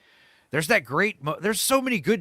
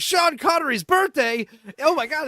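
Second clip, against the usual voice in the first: Sean Connery's birthday? squealed much higher and louder, in hammed-up awe; shocked mobsters; 13 semitones higher, 7 dB louder than usual. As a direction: gasp it out like a room of stunned heavies. All the mobsters gasp in awe.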